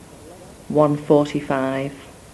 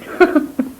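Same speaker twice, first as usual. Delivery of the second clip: high breathy laugh; 11 semitones higher than usual. Real, not acted. Laughs at own accent.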